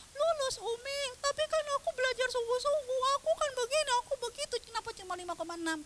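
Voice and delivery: very high mock wail; upset child protesting. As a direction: whine back at mum in a put-on child's cry. Upset child argues with mum.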